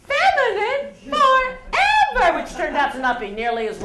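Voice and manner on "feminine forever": mock glamour voice.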